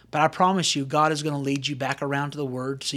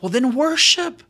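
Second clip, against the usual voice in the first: higher, slow and loud exhortation; emphatic preaching; 6 semitones higher, 4 dB louder, slower than usual. Real, not acted. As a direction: lift it up and stretch it out to urge them. Preacher urging the congregation.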